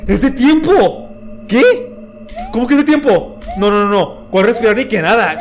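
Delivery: high, loud, stretched disbelief; panicked gamer yelling at the clock; shocked outcry. Real, not acted. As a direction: cry out in real shock at the timer. Shocked timer is running out.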